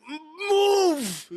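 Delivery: high-pitched re-enacted outburst; enraged yell. Annoyed moviegoer at breaking point, high retold yell. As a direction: burst out high, imitating your own yell. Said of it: "I just needed to yell".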